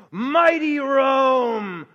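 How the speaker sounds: loud, slow proclamation; booming emphasis. Real, not acted. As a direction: thunder out the might of Rome. Preacher emphasizing Rome's might.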